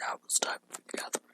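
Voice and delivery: fast, breathy-free unvoiced ominous whisper. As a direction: hiss it like a cult leader. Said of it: "Sounds ominous, doesn't it?".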